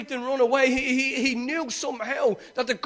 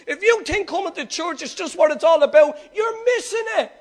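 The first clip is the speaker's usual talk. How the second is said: raised, high-pitched preaching shout; fervent exhortation; 7 semitones higher, 4 dB louder than usual. Real, not acted. Preacher tells churchgoers to go home and pray, fervent shout. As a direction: drive it home at full voice.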